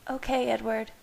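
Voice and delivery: ordinary delivery, nothing marked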